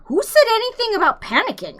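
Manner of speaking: high, loud, snappish retort; indignant snap. A narrator's reading. Arthur snapping back at Ford, high indignant retort. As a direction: snap back, offended at the word. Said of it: "snapped Arthur".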